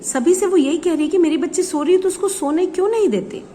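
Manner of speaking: ordinary talk, nothing marked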